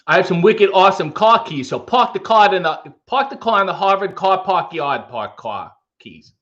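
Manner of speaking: put-on Boston twang; Boston accent. Shown as a demonstration. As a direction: read it like a Bostonian tongue twister. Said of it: "[name] has a Boston sentence".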